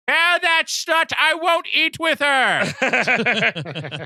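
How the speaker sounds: put-on very high, slow old lady shriek; hammed-up scolding mother, far above normal pitch; Norma Bates. Mother refusing her son's dinner guest, mock-shrieking old lady. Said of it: "you just hear over the P A".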